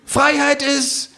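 very high, slow, grand kangaroo; orating kangaroo. Acted. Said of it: "sagt das Känguru".

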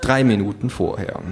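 ordinary delivery, nothing marked

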